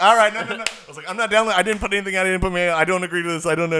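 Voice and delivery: high, loud flustered protest; panicked refusal. Retold or imitated. Refusing to download the scam software, loud flustered no. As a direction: back out fast.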